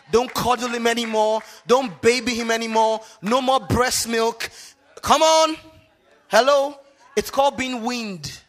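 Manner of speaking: loud, high, hammered preacher delivery; full-force preaching. Real, not acted. Preacher demanding boys be weaned from mothers, full-force preaching. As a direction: fire off each command at the congregation.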